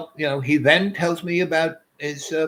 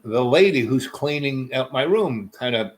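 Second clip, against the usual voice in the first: normal talking voice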